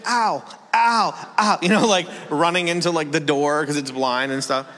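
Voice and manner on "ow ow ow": high mock yelps of pain; imitated pained dog. Blind dog running into the door, imitated pained yelps. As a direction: yelp as if bumping into things. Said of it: "It's pain".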